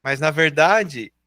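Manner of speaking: ordinary talk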